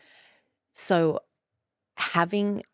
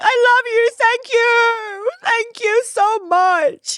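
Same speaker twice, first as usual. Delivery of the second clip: much higher and louder, mock crying; mock weeping gratitude; hammed-up tearful gush; 10 dB louder, much slower than usual. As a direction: sob out thanks in exaggerated emotion. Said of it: "You're like".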